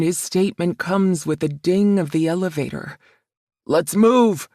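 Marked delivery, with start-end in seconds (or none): 3.7-4.5 louder, booming order. A narrator's reading. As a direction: boom it out to the whole team. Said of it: "his voice booms".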